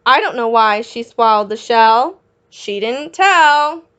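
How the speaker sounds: lively sing-song storybook read; reading to children. A narrator's reading. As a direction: read the rhyme out to little kids. Reading picture book to children.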